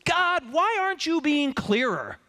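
a frustrated believer pleading upward; pleading with God. Acted. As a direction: drag it out as a frustrated prayer. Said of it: "who has not asked the very same question of God".

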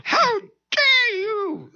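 hammed-up outrage, very high and slow; livid. Livid woman confronting the pastor in her house, mock fury. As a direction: stretch it into a scandalised high squeal. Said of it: "She was livid".

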